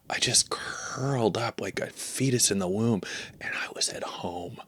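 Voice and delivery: low, very soft confiding whisper; hushed confession. Acted. Tiny landlord confiding to his waking tenant, hushed. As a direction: confess it secretly, fading into breath.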